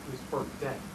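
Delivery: low, very soft, heavy; hushed, low, grave; grim hush. Acted. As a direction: say it quietly, the death sinking in. Reporting the man's death.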